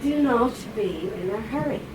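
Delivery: normal talking voice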